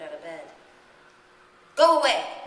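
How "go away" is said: much louder, higher, sharp shout; sharp command. A narrator's reading. Coraline at her bedroom door, shouting sharply. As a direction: drive it off with your voice. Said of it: "said Coraline sharply".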